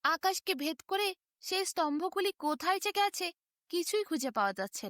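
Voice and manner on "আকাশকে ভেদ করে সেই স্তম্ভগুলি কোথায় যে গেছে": ordinary delivery, nothing marked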